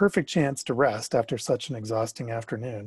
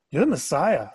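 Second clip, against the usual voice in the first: bursting out, higher and louder; blurted out; 5 semitones higher, 5 dB louder than usual. Acted. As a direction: blurt it out before you can stop. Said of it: "I burst, blurted out".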